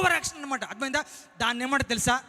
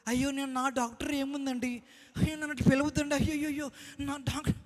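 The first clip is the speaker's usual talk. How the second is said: soft, fussy, overly humble protest; mock false modesty; 2 semitones higher, 8 dB quieter than usual. Preacher mocking a doctor who refuses the title, in soft fake humility. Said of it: "అంత over action చేయకూడదు".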